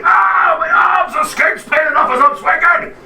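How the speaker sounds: high, loud flailing giant shout. Acted. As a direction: yell like a clumsy giant. Giant voiced while swinging wildly.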